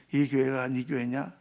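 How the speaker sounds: slow, low, searching question; quiet voice of God asking. Retold or imitated. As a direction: ask it slowly, probing. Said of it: "나한테 말씀하시는 거예요".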